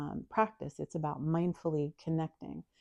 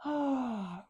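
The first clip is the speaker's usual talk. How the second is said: long yawning sigh; falling yawn sigh; demo yawn; 7 semitones higher than usual. Shown as a demonstration. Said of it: "Do another one".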